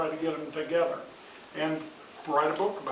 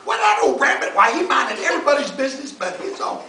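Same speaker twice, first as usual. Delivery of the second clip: higher, louder fox voice; Brer Fox; 7 semitones higher, 7 dB louder than usual. Acted. Fox railing against the rabbit, high and loud. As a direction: squeal it out indignantly.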